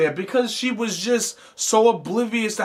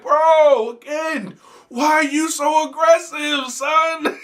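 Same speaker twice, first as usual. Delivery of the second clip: much higher, louder shout of "why are you so aggressive"; full exasperated yell; 12 semitones higher, 4 dB louder, slower than usual. Retold or imitated. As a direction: yell at the stalker in disbelief. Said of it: "And I'm like".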